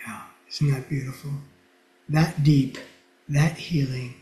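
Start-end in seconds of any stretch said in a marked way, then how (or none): none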